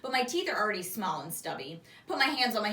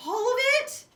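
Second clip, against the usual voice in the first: high, loud disbelief; can't believe it; shocked; 14 semitones higher, 7 dB louder than usual. A narrator's reading. Shocked at forgetting.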